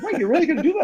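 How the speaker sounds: high, amused disbelief with a laugh; incredulous buddy. Retold or imitated. Buddy laughing in disbelief at his plan, high and amused. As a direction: gasp out a laughing what, you're really. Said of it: "he's like".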